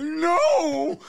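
very high loud mock scream; anguished cry. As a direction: shriek it like watching it die. Retold horror at dying squirrel.

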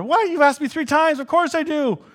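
high, loud exasperated protest; exasperated Peter. Retold or imitated. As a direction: Peter hurt at being asked again.

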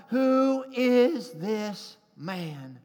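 drawn-out, weighty refrain, slowly; slow dramatic question. Acted. Preacher to congregation, slow weighty question. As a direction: let the question hang slowly.

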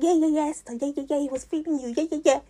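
high, chanting egging-on; imitating hype friends. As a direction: mimic friends hyping someone up. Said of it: "they were hyping me".

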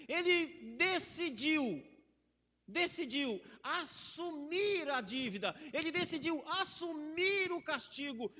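loud, slow, fervent preaching; full force preaching. Acted. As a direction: proclaim it to the whole church.

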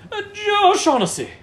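playful Irish lilt on the name; Irish accent. Pretend. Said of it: "using the Irish accent".

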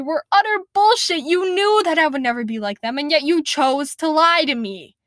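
rising bitter fury at the liars; angry accusation. Acted. Lacey turning on the people who lied to her, bitter anger. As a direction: spit it out, betrayed and furious. Lacey confronting the lying monsters.

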